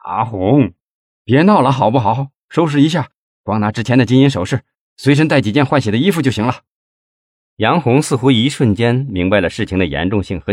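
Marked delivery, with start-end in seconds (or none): 0.0-6.6 higher, hurried instructions; pressing, quick-fire orders; urgent. A narrator's reading. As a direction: hustle her along impatiently. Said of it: "急切地说".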